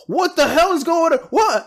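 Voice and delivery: high, loud panicked cry; panicked scientist. Acted. GM voicing scientists panicking as they turn invisible, high and loud. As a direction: shriek like a startled lab scientist.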